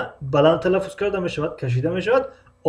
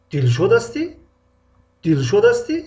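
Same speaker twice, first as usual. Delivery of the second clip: Дилшод ҳастӣ with a rising, louder ҳастӣ; emphatic re-ask; 3 semitones higher, 4 dB louder than usual. Acted. Teacher acting someone re-checking a name, emphatic rise. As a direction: lift ҳастӣ as if double-checking. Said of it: "боз таъкид мекунем".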